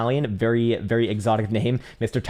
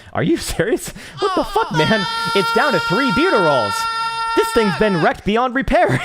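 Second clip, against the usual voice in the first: squealing high and loud, half laughing in disbelief; real shock at the chart, voice flying up; loud high-pitched what-the-hell disbelief; incredulous outburst; 5 dB louder than usual. Real, not acted.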